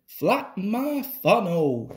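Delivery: put-on wheezy engine voice; wheezing exclamation. A narrator's reading. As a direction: wheeze it out as a tired old engine. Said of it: "he wheezed".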